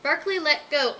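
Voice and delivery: ordinary delivery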